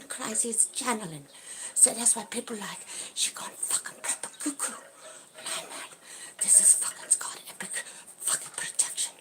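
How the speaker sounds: hushed, fast whisper; secretive whisper. Real, not acted. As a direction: keep it from the neighbours. Whispering so neighbours won't hear.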